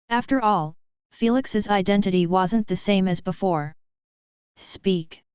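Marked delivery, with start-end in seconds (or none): 4.6-5.2 blunt. A narrator's reading. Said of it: "King Mahit spoke bluntly".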